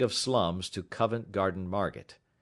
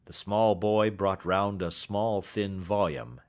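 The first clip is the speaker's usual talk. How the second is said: ordinary delivery, nothing marked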